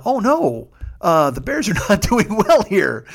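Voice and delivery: high, laughing mock dismay. Realizing the Bears are losing, high mock dismay. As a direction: fake a dawning dread. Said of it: "slowly dawns on us".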